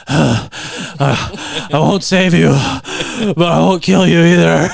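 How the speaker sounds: loud gruff Batman yell; mock Batman shout. Friend mocking Batman to Ra's al Ghul, loud gruff shout. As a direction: bellow it like an overacting hero. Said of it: "he's like".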